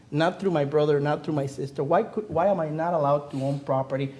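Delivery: ordinary talk, nothing marked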